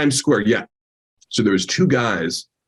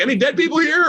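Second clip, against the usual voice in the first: much higher fake-innocent voice; mock innocent passerby. Teller pretends to circle back in disguise asking, much higher. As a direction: play the nosy passerby, pitched high. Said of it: "and be like".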